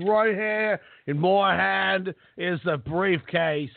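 slow, hammed-up British accent. Jokingly cashing in the invisible briefcase, mock British accent. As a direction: put on a drawn-out mock British voice. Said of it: "British accent, sorry".

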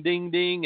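ping it out like a phone notification; imitated phone ding. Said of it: "my phone's going ding".

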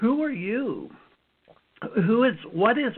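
confused inner voice during the surge; startled questioning. Retold or imitated. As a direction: ask the unknown force who it is. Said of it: "I'm like".

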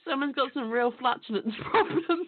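words broken by laughter; giggling while she says it; laughing. Real, not acted. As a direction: laugh through the line. Laughs at fart joke.

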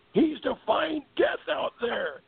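high, slow, dramatic announcer voice; dramatic Chris Economaki. Retold or imitated. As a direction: stretch it out like an old-school broadcaster. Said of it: "Chris Economaki would always say".

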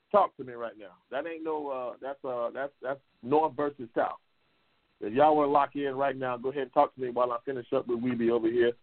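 normal talking voice

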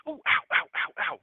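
pained ows, high and breathy; walking on hot sand. Pretend. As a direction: yelp at hot sand underfoot.

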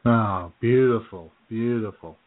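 normal talking voice

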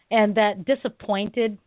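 usual speaking voice

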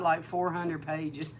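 usual speaking voice

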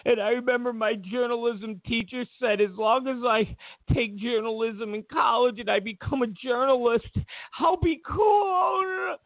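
high, crying whine rising to a wail; mock-tearful reporter whining about school; mock whiny journalist. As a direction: sob it out like a hurt loser. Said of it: "It's like".

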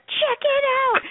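put-on high-pitched squeal of a child; excited kids. Kids showing mom gold-wrapped Pop-Tarts, thrilled. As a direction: show it off in a squeaky kid voice. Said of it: "they were like".

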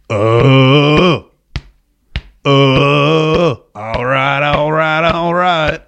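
imitated actor's chest-thumping hum into a chant; long droned hums, then rhythmic repeated words; Matthew McConaughey. Host re-enacting the actor's chest-thump scene, droning chant. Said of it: "how he did that scene".

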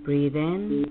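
slow, soothing meditation voice; calm meditative tone. Acted. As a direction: guide them gently into calm. Leads a guided meditation.

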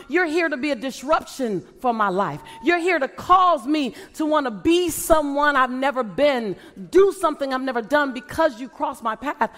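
rally the crowd to step up, question after question; fervent preaching. Acted. Speaker challenging the audience to chase their dream, fervent preaching.

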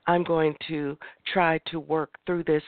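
ordinary talk, nothing marked

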